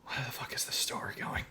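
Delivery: hushed unvoiced aside; whispering. Real, not acted. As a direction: whisper it to yourself in disbelief. Aside said under his breath.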